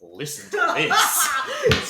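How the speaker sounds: slow, high, laughing build-up; dramatic teaser. Acted. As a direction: stretch it out like a teaser. Teasing build-up for next part.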